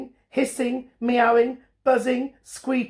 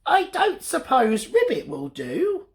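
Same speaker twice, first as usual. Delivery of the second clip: frog asking; 9 semitones higher than usual. Acted. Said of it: "asked the frog".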